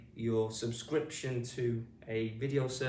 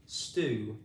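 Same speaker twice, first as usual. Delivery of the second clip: ordinary delivery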